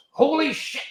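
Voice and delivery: high, startled mock gasp of shock; shocked exclamation. Retold test pilot as his jet departs, high mock shock. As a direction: blurt it like the jet just departed. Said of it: "the test pilot goes".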